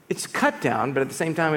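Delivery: usual speaking voice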